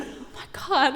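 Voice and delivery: ordinary talk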